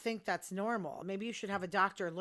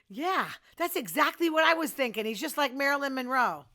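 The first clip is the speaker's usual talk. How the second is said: higher, louder mock agreement; sarcastic; 5 semitones higher, 7 dB louder than usual. Real, not acted. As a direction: drip sarcasm over it. Mocking [name]'s comparison.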